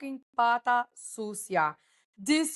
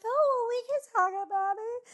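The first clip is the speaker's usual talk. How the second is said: mimicking Amanda; 11 semitones higher than usual. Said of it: "And she was like".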